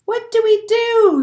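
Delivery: high, loud group shout; shouted question. Acted. Other penguins shout to the little penguin. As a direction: call it out together. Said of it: "shout all the other".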